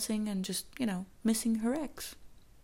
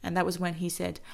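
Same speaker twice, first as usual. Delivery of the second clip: usual speaking voice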